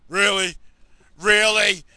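loud, exasperated repeated protest; a fed-up kid snapping back at teasing; annoyed kid. Retold or imitated. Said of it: "He's getting mad at us".

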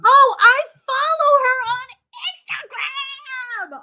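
very high, loud squeal; excited recognition. Real, not acted. Spotting an account she follows on Instagram, excited shriek. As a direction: shriek it with delight, drawing out the end.

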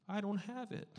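high-pitched mock excuse; put-on high little voice; mock helpless excuse. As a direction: pitch it up high, playing at being broke. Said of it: "Sorry, that was a little".

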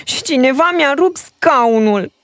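put-on baby bear shrieks about his broken chair; little bear crying out. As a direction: wail it like an upset cub. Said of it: "țipă ursulică".

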